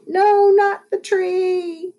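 high, slow, stretched-out cry; panicked wail. A narrator's reading. Skateboarder heading into a tree, panicked wail.

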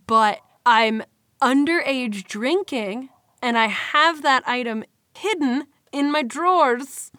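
ordinary talk

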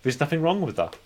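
usual speaking voice

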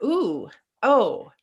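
light, wondering excitement; excited ooh. Retold or imitated. Recalling a freeing moment in acting, delighted. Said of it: "And then I was like".

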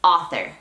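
"author" as AH-ther, American R at the end; teaching "author", rhotic ending; American pronunciation. Shown as a demonstration. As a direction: model "author" clearly with stressed AH. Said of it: "Enfoquémonos en".

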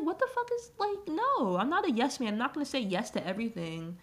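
starts very high then settles back down; hammed-up squeal of indignation easing into a flat refusal; retold gamer fed up with a pushy companion, mock outrage; mock outraged protest. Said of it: "And I'm like".

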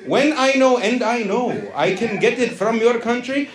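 high, hammed-up Indian accent, settling at the end; excitable Indian-accented haggler. Comic demanding steroids from their country, excitable accented rant. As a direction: argue heatedly in accent, then ease into a sales patter.

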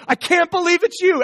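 high, loud cry of joyful disbelief; overjoyed disbelief. Acted. Mary throwing her arms around Jesus, high joyful cry. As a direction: shout it like you can't believe he's back.

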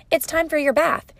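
high, singsong parent announcement; parent to toddler. Shown as a demonstration. Parent calling time for a bath, high and lilting. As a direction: pitch it up as to a small child.

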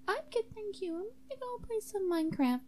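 high-pitched, casual sidekick; high-voiced sidekick. High character declines the song.

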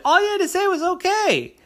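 very high, loud scolding; high squeaky reproach; exasperated sister. A narrator's reading. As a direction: scold him in disbelief. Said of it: "And sister said".

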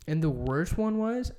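ordinary talk, nothing marked

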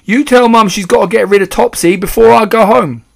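high, loud, insistent order; boyish shout. A narrator's reading. Mouse boy ordering his father from handbag, shouting. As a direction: shout it bossily in a boy's voice. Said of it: "Bruno shouted from inside the handbag".

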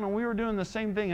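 usual speaking voice

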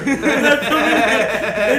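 ordinary delivery

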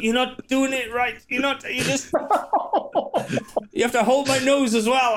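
high, squeaky complaining voice; choked guy complaining. Acted. Dying guy tells Sturdy he is choking him wrong. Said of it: "the guy's like".

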